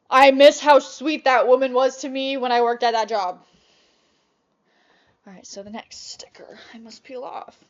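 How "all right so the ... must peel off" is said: soft unvoiced whisper to self; whispered aside. Real, not acted. Peeling a sticker off her laptop, whispered to herself. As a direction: murmur it as you peel the sticker.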